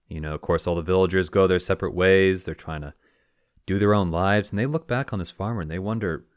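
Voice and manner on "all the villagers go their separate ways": ordinary delivery, nothing marked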